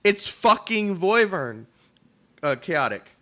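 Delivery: high, loud and slow, stretching out Voivern; hammed-up fan shouting the wrong name; mock Comey yell. Friend Comey mocks the mispronounced name at a convention, loud and slow. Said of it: "Comey's literally just gonna go".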